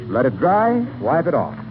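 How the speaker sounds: ordinary talk, nothing marked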